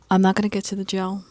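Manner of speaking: ordinary talk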